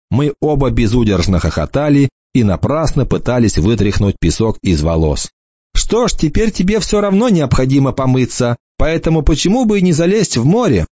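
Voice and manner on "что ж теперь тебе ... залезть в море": высокий задорный девичий голос; дразнит, поддразнивает свысока; насмешливая девушка. A narrator's reading. Голос Эммы в рассказе. (Эмма дразнит рассказчика, насмешливый девичий голос)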